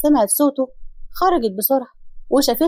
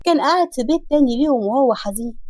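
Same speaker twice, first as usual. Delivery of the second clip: ordinary delivery, nothing marked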